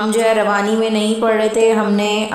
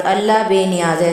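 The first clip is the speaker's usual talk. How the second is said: usual speaking voice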